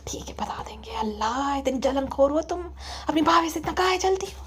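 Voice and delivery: hushed, soft scolding from a mother; mother gently scolding. Acted. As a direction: scold your daughter almost in a whisper. (अम्मी की दबी आवाज़ में डाँट)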